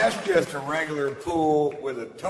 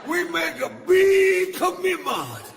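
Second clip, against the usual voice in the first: high-pitched comic shout; 14 semitones higher than usual. Acted. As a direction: yell it, much higher. Shouted escalation.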